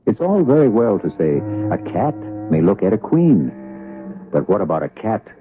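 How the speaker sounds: ordinary talk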